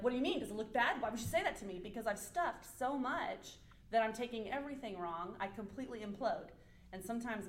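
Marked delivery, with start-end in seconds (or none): 0.0-1.8 sudden quick, louder defensive burst; mock outburst. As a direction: act out blowing up over an innocent question. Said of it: "all of a sudden explode".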